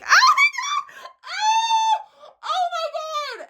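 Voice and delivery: very high, drawn-out screaming; over-the-top squealing outburst; mock ecstatic amazement. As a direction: shriek in hammed-up overwhelmed joy. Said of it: "Not like".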